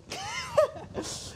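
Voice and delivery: squeaky nervous laugh; a high, soft giggle; nervous squeal of a laugh. Real, not acted. Nervous laugh at own joke.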